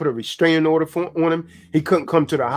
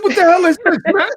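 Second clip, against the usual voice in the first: high, loud "what the hell"; high-pitched incredulous yell; shocked disbelief; 12 semitones higher, 5 dB louder than usual. Retold or imitated. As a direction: act floored by what you hear. Retold reaction to brother's voice.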